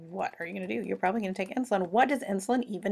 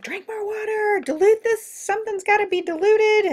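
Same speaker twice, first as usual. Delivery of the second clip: high, panicky kidney voice; high frantic mock alarm; freaking out; 13 semitones higher, 5 dB louder than usual. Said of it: "kidneys are freaking out".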